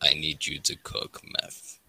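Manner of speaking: very low, slow, quiet impression. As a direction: drop deep and measure it out softly.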